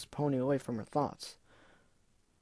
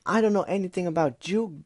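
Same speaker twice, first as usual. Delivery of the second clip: sarcastic; 5 semitones higher, 4 dB louder than usual. Acted. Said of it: "Gilda said sarcastically".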